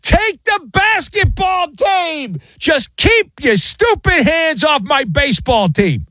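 a sinking owner begging at full voice; mock desperate scream. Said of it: "he's screaming at the top of his lungs".

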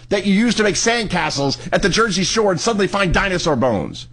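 exasperated high-pitched rant; yelling. Real, not acted. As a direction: rant at the guest, voice climbing high. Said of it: "I shouldn't be yelling".

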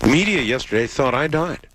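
usual speaking voice

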